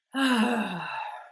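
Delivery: a big voiced sigh out through the mouth, dropping in pitch; loud sighing exhale. Shown as a demonstration. Demonstrating the active breath to viewers with a loud sigh. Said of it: "exhaling through the mouth with sound".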